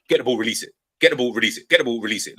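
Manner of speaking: lightly voiced chanting coach; coaches' mantra. Academy coaches drilling the player, mild mock chant. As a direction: repeat it like a drilled coach slogan. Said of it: "it was all about".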